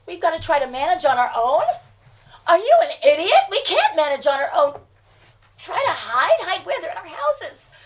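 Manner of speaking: loud furious disbelief; angry shouting. Acted. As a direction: yell at the useless dispatcher. Outraged at police.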